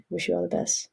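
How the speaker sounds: usual speaking voice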